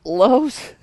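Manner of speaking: put-on high, disbelieving boy asking you want my loaves; a kid who can't believe anyone wants his lunch; mock astonished boy, pitch jumping up on each question; incredulous kid. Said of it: "the kid's like".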